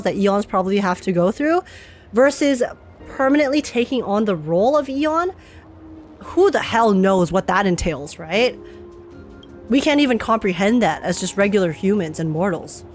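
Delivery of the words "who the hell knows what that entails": normal talking voice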